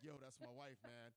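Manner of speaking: lightly voiced jealous husband, low; mock tough husband. Imagined husband warning him off, lightly low and tough. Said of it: "act like".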